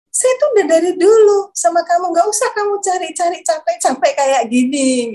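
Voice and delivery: high, insistent retold divine reply; lightly acted voice from above; God's voice. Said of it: "Saya percaya itu Tuhan".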